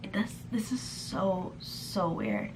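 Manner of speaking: slow, hushed whisper; breathy, slowed conspiratorial whisper; whispered aside. Real, not acted. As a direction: lean in and whisper it like a secret. Aside about the strange texting.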